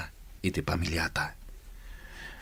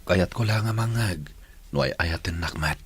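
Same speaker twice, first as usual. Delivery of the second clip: ordinary talk, nothing marked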